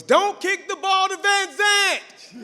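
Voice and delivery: high-pitched, drawn-out sideline yell; Coach Gorzinski yelling. Retold or imitated. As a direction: imitate a coach hollering across the field. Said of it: "he could hear you from across the field".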